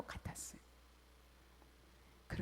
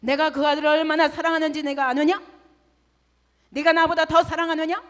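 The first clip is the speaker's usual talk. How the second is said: loud, higher thundering rebuke; God rebuking; 5 semitones higher, 15 dB louder than usual. Retold or imitated. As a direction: boom it as God's challenge. God's rebuke voiced loudly. (우렁차게 꾸짖는 하나님)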